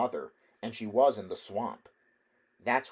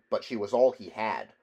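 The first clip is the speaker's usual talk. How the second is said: plain reading voice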